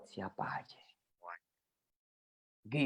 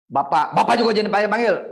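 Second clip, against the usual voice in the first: mock scolding parent; 7 semitones higher, 6 dB louder, faster than usual. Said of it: "apa kata ibu kita?".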